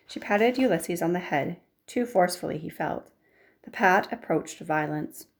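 usual speaking voice

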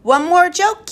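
high, loud and jolly; merry urging. A narrator's reading. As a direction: egg them on for another. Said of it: "The professor laughed".

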